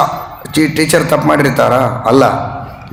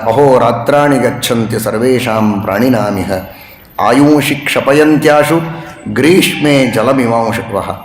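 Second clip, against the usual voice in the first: shloka recitation; 3 semitones lower than usual. Acted. Preacher reciting Rama's verses to the audience, chanted. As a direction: intone each line like scripture.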